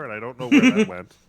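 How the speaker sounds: exasperated outburst. Real, not acted. Frustrated at lost note.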